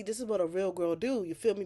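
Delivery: ordinary talk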